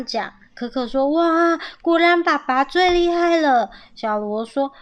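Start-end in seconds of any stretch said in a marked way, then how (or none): 1.1-3.7 high, louder wow of praise; admiring delight. A narrator's reading. Young squirrel praising dad's pulling, delighted. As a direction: cheer dad on in a lifted voice.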